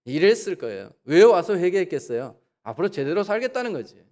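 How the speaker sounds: ordinary delivery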